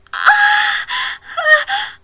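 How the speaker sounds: very high, very loud cries of climax; cry of pleasure. Acted. A woman crying out at climax with her lover. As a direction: cry out sharply at the peak.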